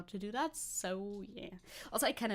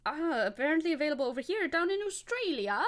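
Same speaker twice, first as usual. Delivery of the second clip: higher-pitched, bad attempt at an Australian accent; failed Australian accent; 5 semitones higher, 3 dB louder than usual. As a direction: try an Aussie accent and miss. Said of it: "That was not at all an Australian accent".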